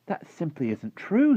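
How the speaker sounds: high, slow, loud contradiction; indignant, high-pitched outburst; angry cry. A narrator's reading. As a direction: object angrily as a young girl. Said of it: "Sophie cried angrily".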